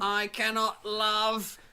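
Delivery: high, slow robot monotone; a stiff, slow machine. Retold or imitated. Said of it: "deadpan robot comedian".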